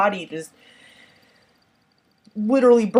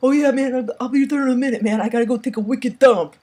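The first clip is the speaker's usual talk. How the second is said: higher, louder laid-back dude; 5 semitones higher, 5 dB louder, faster than usual. Retold or imitated. Older kid brushing off baseball, loud casual bro voice. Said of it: "the guy's like".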